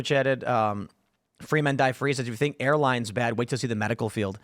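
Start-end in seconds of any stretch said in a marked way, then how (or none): none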